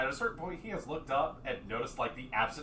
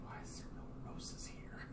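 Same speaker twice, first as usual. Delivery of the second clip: soft unvoiced muttering to himself; 12 dB quieter than usual. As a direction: grumble it under your breath. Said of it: "you hear him start muttering".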